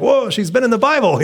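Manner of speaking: high, loud amazed outburst; acted-out thrilled reaction; excited, encouraged. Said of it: "you're just so encouraged".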